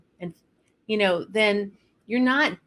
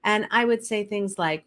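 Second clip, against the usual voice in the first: ordinary delivery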